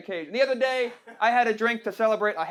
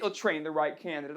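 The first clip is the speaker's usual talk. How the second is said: "They'll train the right candidate" repeated lower and much softer; mocking echo; 7 semitones lower, 8 dB quieter than usual. Shown as a demonstration. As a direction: mutter it back as a sarcastic echo. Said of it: "But they'll train the right candidate".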